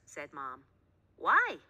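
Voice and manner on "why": high, loud startled question; surprised why. A narrator's reading. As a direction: ask it in disbelief. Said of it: "said Sid".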